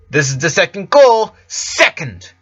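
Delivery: loud, high stage announcer calling out. Acted. Announcer gives the second call, loud and high. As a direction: boom it across the backstage.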